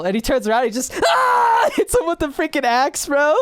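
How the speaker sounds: high, loud, laughing excitement; high and loud, laughing as he tells it; excited. Real, not acted. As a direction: gush about the axe scene. Excited retelling of axe scene.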